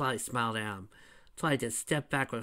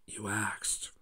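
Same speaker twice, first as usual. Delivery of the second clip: softer, lower dry retort; smirking deadpan; 4 semitones lower, 9 dB quieter than usual. Retold or imitated. Shadow smugly answering the changelings, soft and low. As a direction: drop it with a smug smirk. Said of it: "Shadow just smirks, going".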